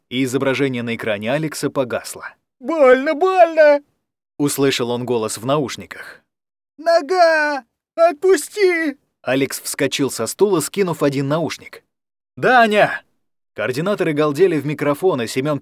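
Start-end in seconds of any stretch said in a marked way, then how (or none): 2.6-3.8 high, loud cry of pain. Acted. Attacked Даня screams in pain into the headset, high and loud. 6.8-9.0 high, loud, slow desperate scream. Acted. Даня screams at whatever grabs his leg, high and loud. 12.4-13.0 loud, higher shout; alarmed shout. Acted. Алекс shouts for Даня after the screams, loud and higher.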